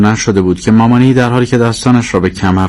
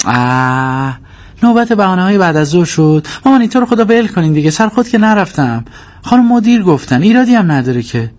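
high-pitched whining protest; long groan rising into a high, impatient complaint; exasperated daughter; 12 semitones higher than usual. Acted. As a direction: plead to be left alone, fed up. Daughter protests mother's excuses.

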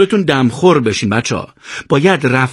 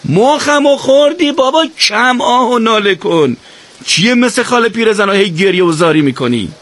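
much higher, exasperated yell; shouting, fed up; 9 semitones higher than usual. Acted. Ali yelling at Hossein to stop moaning, exasperated. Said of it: "داد زد".

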